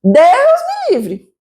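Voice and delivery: high, drawn-out exclamation of refusal; mock horrified refusal. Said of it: "Aí você fala".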